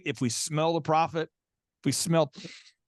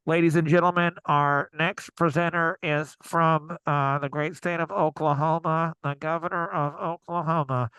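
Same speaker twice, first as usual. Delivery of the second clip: mock announcer; 2 semitones lower than usual. Host mimicking the conference announcer for the panel. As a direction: boom it out like a hired emcee. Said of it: "an androgynous announcer".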